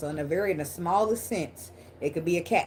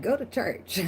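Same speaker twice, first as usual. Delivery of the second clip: laughing mockery; 5 semitones higher, slower than usual. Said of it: "they come on my video talking about God".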